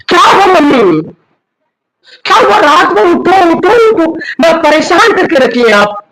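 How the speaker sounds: high, loud annoyed complaining; irritated son. Acted. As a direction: snap back at mother, fed up. Son angrily complains at mother.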